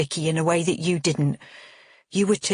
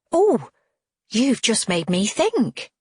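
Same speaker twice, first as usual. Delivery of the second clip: a sudden high, louder "Oh" of realising; excited cry; 5 semitones higher, 3 dB louder, slower than usual. A narrator's reading. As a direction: burst out with a bright idea. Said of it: "Cheryl cries".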